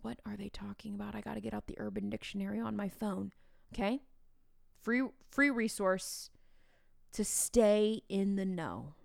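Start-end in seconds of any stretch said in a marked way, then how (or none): 0.0-3.3 secretive whisper; teacher sneaking a phone in class, whispering. Retold or imitated. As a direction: whisper so the class won't hear. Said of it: "sneak on your cell phone".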